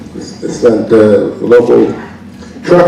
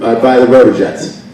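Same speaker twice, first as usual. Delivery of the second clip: ordinary talk, nothing marked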